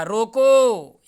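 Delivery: रोको called out loud and high, then a break; loud demonstrated command on रोको; stop after रोको. Shown as a demonstration. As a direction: stretch रोको and halt on it. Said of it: "यहाँ विराम चिन्ह लिया".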